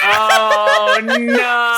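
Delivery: loud laughing groan of dismay; laughing shout. Real, not acted. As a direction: burst out laughing, horrified. Cringing at embarrassing reveal.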